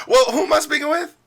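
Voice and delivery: sugary polite phone voice; cheerful comeback. Pretend. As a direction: kill her attitude with sweetness. Roleplay call, cheerful despite attitude.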